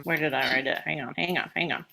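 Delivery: under her breath while searching; quiet aside. Real, not acted. Host pausing to look for her notes, quiet to herself.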